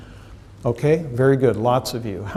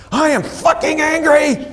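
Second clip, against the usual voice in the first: much higher, explosive angry yell; furious shout; 12 semitones higher than usual. Acted. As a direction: blast it out like a furious kid. Child voiced, furious outburst.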